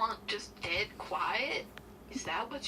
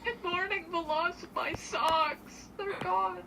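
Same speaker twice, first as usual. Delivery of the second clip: mock sorrow; 7 semitones higher than usual. Mock grieving over socks.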